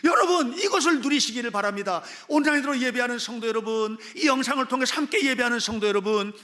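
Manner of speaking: loud, urgent exhortation to the congregation; full-force preaching. Real, not acted. Preacher urging online and gathered worshippers, forceful exhortation. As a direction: drive each phrase out to everyone listening.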